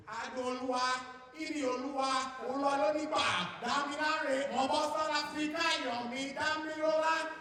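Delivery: high-pitched mum calling out names; high, insistent household wake-up call; Nigerian mother's roll call. Acted. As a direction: rattle off the children's names in a high African mother's voice. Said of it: "she went through the list".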